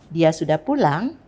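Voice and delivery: strong rise on PULANG; asked with a climbing final PULANG; questioning intonation. Shown as a demonstration. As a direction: make it a question, PULANG swinging upward. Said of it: "intonasi pertanyaan".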